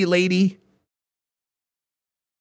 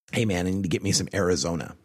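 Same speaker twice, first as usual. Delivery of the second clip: mock stoner; 10 semitones lower, 5 dB quieter, faster than usual. Mock stoner asking for weed.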